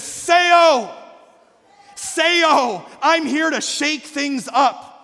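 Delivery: high, loud preacher's proclamation; proclaiming shout. Acted. Preacher voicing Jesus to shake up the church, proclaiming shout. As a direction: cry it out, then land it slowly.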